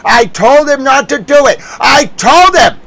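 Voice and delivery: exasperated shout. Real, not acted. Streamer reminding everyone he warned: exasperated shout. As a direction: yell the warning you already gave.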